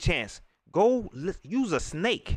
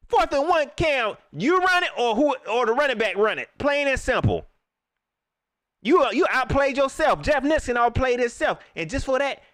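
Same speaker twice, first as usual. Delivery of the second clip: raised, higher-pitched scolding; heated rebuke; 6 semitones higher, 3 dB louder than usual. Real, not acted. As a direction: lay down the law, worked up and emphatic. Angry rant at quarterback and coach.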